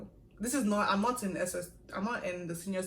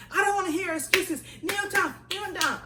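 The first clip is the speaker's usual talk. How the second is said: much higher, loud command; shrill angry order; 11 semitones higher, 6 dB louder than usual. Retold or imitated. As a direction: shut her excuses down. Senior shouting order.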